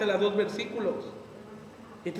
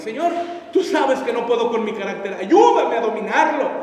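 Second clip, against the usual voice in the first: mock pleading prayer; 9 dB louder than usual. Believer excusing his temper to God, mock pleading.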